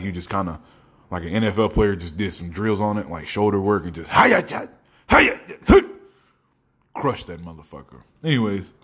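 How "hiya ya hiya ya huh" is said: high, loud martial-style exertion yelps; mock drill grunts. Imitating an NFL player doing shoulder drills, exertion yells. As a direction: bark out effort noises like hitting a tackling dummy.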